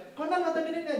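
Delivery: high-pitched pestering mom; insistent mother. Retold or imitated. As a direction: lightly imitate a mother prodding her child. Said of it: "mama saya ngotot". (menirukan ibu yang menagih)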